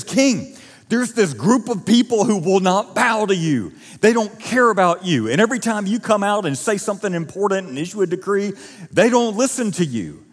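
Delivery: high, loud, urgent pitch to the king; urgent persuading. Acted. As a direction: rile the king up with alarm. Haman pleading to the king.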